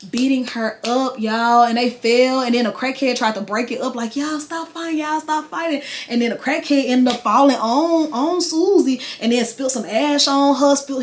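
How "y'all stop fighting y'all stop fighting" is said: retold bystander plea. Crackhead trying to break up the fight, high-pitched imitation.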